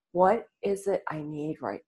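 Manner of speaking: breathy hushed self-question; soft meditative whisper. Shown as a demonstration. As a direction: whisper it as if inward. Guided self-questioning in stillness.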